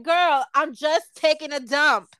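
loud, slow, exasperated protest. Retold or imitated. Son on the toilet, fed up with mom's calls: loud and slow. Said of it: "I'm like".

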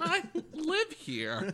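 high, slow mock indignation; playful high protest. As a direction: drag it out, squeaky and offended. Mock quarrel over house.

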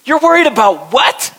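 disbelieving heavenly witnesses asking what we fear; incredulous onlookers. Retold or imitated. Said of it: "They're going".

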